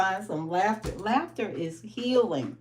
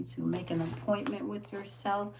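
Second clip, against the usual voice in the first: normal talking voice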